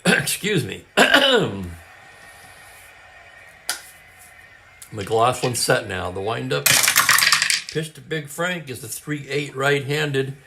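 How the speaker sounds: brisk radio ballgame call, lightly lifted; live play-by-play. Acted. Host calling a tabletop baseball game, play-by-play voice. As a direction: call it like a classic radio announcer.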